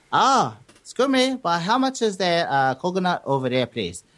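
put-on joke-telling Chinese customer accent, jumping up high then settling; hammed-up Chinese accent, politely asking. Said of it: "this is my best, um, Samoan accent".

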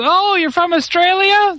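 high, mock-puzzled American woman; mock clueless American. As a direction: play her as a ditzy, surprised tourist. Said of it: "And she goes".

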